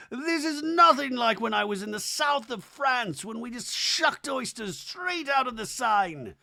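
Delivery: high-pitched mock theatrical bon vivant; hammed-up grand reminiscing; Whitney. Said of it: "I wish Whitney sometimes would speak up".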